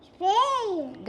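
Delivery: called out loud and high; belted-out country name; loud answer. Real, not acted. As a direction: shout out the quiz answer. Child calling out quiz answer.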